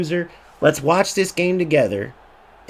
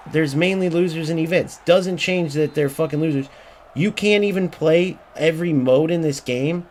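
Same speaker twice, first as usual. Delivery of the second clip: ordinary talk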